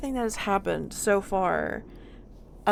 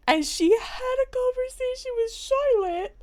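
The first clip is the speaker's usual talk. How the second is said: high, excited and louder; 5 dB louder than usual. Real, not acted. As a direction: voice jumps up with excitement. Excited retelling, voice jumps up.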